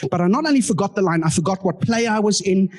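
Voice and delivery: ordinary talk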